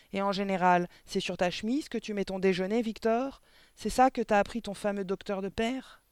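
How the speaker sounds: high, loud mocking interrogation; sarcastic headmistress. A narrator's reading. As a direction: needle the boy about his shirt and father. (directrice sarcastique, voix haute et forte)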